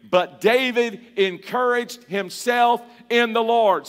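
slow unison recitation; recited together. Shown as a demonstration. Preacher leading the church in the verse, slow recitation. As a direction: lead the congregation through the verse. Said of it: "say it together with me".

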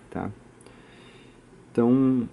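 ordinary delivery

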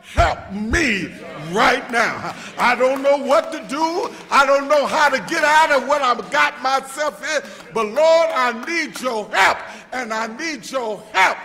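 loud pleading prayer, crying out to the Lord; desperate prayer. Acted. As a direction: beg God for help at full voice. Opens with an acted desperate prayer.

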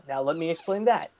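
ordinary talk

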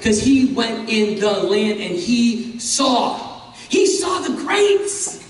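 he saw the grapes, in awe; slow rising excitement. Acted. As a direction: build up awe. Preacher builds excitement.

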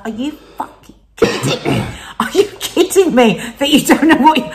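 mock-furious disbelief at him; exasperated. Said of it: "I was like".

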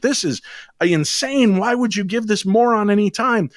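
high-pitched incredulous protest; imitated indignation. Skeptical listeners object to the caller, mimicked indignation. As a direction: push it up high like an outraged listener.

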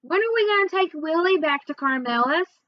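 high, nagging little brother; mildly insistent child's question; pestering question. A narrator's reading. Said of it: "Then he kept asking".

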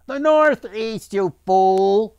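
loud, high jeer; shouted for all. A narrator's reading. Islander corrects another for all to hear, loud shout. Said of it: "shouted for all to hear".

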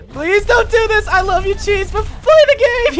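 high-pitched mock begging; hammed-up desperate appeal; playful pleading. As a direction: beg in a high, whiny plea. Pleads with friend to play the game.

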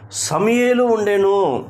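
high, drawn-out proclamation; emphatic answer. Real, not acted. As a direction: raise the pitch high and stretch it out. Answers own question to congregation, emphatically. (గట్టిగా నొక్కి, పై స్వరంలో సాగదీస్తూ)

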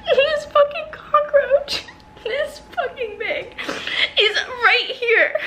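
high, slow, strained panic; shrieking out the bug's size, half laughing; panicked. Real, not acted. She tells viewers the cockroach was right there, panicking.

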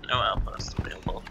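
soft muttered whisper; whispered aside. Real, not acted. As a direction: read it under your breath. Muttering game text to himself.

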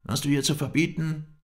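high, loud counter-challenge; angry retort. A narrator's reading. Sheikh challenges the guest in return, angry. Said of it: "antwortete er mir".

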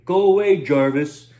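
loud yell, go away punched. Acted. Roy yelling at Jarvis to leave. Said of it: "Roy yelled".

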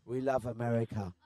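low, deadpan repeat for laughs; mock patriotic answer. English guest repeating his joke answer to the host, mock earnest.

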